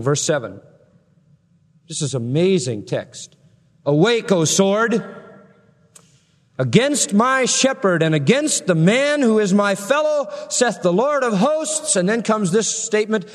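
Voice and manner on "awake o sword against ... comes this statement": slow, high, ringing scripture reading; scripture proclaimed. Acted. Preacher reading God's call to the sword aloud, slow and ringing. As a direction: declaim God's command like a herald.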